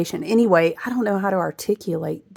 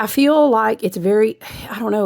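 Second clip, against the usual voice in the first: normal talking voice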